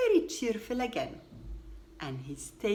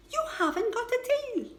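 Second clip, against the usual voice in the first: surprised protest; 6 dB louder, faster than usual. A narrator's reading. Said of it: "Noddy was very surprised".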